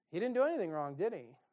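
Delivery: normal talking voice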